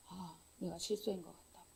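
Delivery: very soft, low muttered admission; quiet admission. Retold or imitated. The other pastor quietly admitting he made a mistake, hushed. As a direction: let it fall almost under the breath.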